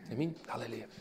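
hushed, breath-only whisper; barely audible, confiding whisper. Real, not acted. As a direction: whisper it to the congregation. Preacher drops into whisper mid-sermon.

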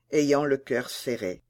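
ordinary delivery

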